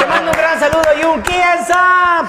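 high, loud shouted character greeting; hammed-up high-pitched shout; Krillin. Shown as a demonstration. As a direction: blast the greeting out in a high cartoon voice. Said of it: "la voz de Krillin".

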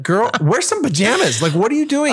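high-pitched, incredulous scolding; mock exasperation. Husband replaying retort to cold wife in bed, mock exasperation. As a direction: protest in comic exasperation, pitch climbing.

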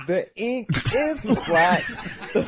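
high, slow, laughing recital; laughing mock recital. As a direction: recite it grandly through laughter. Dramatic recited line, laughing.

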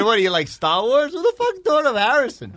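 mock disbelief. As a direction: act shocked at the name. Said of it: "I said".

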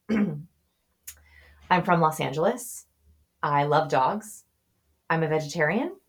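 slow demo with the last line rising like a question; question inflection on the lie. Shown as a demonstration. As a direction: read out two truths and a lie, lifting the end of the lie. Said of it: "might sound like this".

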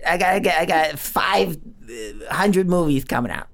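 high, hurried put-on director voice; Spielberg impression. Film director telling podcasters he must return to editing, high impression. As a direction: imitate a busy director excusing himself in a high voice. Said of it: "Mister Spielberg, you came back into the room".

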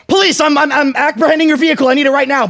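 high, loud fake police voice; commanding cop shout. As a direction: bark orders like an impostor cop.

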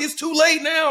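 high, loud mock fan retort; heckler. Heckling fan answering Gerald, high mock retort. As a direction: snap back like a stubborn fan. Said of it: "he was like".

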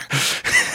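sudden high-pitched laugh; a squeaky giggle fit; high laugh burst. Real, not acted. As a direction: crack up out of nowhere.

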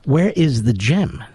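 slow "Where is the gem?" with "where" rising; correct phrasing. Shown as a demonstration. Host correcting gem show phrasing. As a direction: say it slowly and correctly, "where" lifted. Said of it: "Not where's the gem at".

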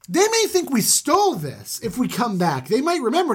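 high, emphatic pleading with dad; pleading sons. Acted. Sons pleading with their father.

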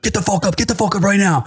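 mock-menacing rushed order; urgent order. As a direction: imitate boys ordering a kid out of bed. Older boys rousing him at gunpoint.

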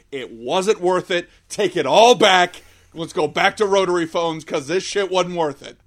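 loud mock outrage, slowing down; exasperated. Real, not acted. Podcast host ranting about a bad movie, loud and exasperated. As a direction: take it all back, loudly.

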